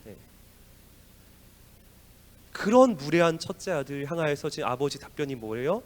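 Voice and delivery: ordinary talk, nothing marked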